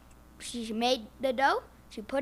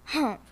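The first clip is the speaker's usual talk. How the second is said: strained grunt. Acted. Hen strains to hump.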